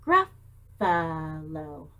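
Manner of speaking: Gruffalo slowly split into three clapped beats, Gruff-a-lo; clapped syllables. Shown as a demonstration. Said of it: "how many syllables?".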